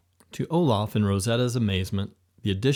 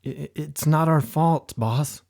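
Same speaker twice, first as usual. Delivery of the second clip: higher, stammering excuse to the boss; stuttering sheepishly; 4 semitones higher, 4 dB louder than usual. A narrator's reading. Henchmen excusing themselves to the angry boss, sheepish. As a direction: trip over the words, guilty and nervous. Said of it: "stuttered sheepishly".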